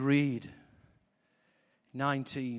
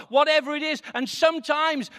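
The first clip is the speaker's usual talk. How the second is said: usual speaking voice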